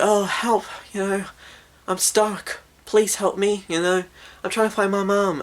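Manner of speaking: put-on high-pitched girl pleading for help; little girl's voice. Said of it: "He hears the voice of the girl".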